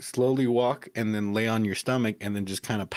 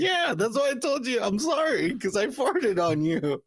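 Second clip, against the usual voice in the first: high, laughing apology that slows at the end; laughing so hard the words wobble; 12 semitones higher than usual. Retold or imitated. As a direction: apologise while cracking up. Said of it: "I was like".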